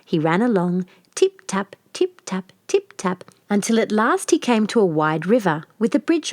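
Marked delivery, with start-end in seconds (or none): none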